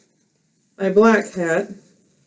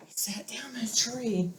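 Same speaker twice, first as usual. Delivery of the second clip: much softer, whispered narration; 16 dB quieter than usual. Real, not acted. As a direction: whisper it as he settles down. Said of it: "whispered line while sitting".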